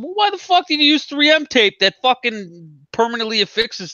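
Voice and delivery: high-pitched, exasperated rant; indignant retold protest, voice shooting up; retold angry outburst. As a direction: act out losing it at customer service. Retells his angry quarrel with company.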